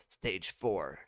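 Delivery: ordinary delivery, nothing marked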